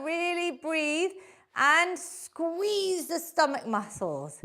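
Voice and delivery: ordinary talk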